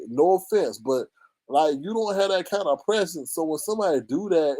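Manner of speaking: ordinary delivery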